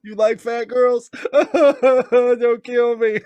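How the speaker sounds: high, slow laughing words. Real, not acted. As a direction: laugh through the joke. Laughing, joking.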